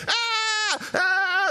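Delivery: very high, loud screams of terror; high shrieking cries; terrified scream. Retold or imitated. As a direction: scream like men who see a ghost. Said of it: "here's the apostles all screaming".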